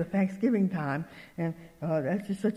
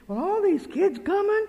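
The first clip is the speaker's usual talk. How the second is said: much higher, fretful imitation; imitated worried fretting; 11 semitones higher, 3 dB louder than usual. Lady worried about camp kids, imitated high fretting. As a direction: voice the lady's worry high up.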